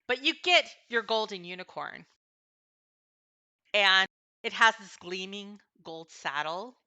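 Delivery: ordinary talk, nothing marked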